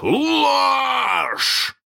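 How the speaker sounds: very high, loud, stretched bellow; long anguished yell; hoarse roar. A narrator's reading. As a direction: roar one word in outrage. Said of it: "хрипко взревел Крюк".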